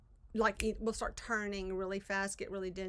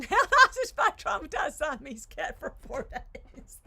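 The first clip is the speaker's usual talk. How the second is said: talking through real laughter, pitch breaking up; laughing; 4 semitones higher, 7 dB louder than usual. Real, not acted. Friend explains being stuck with a traumatized cat, laughing. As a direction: laugh your way through the complaint.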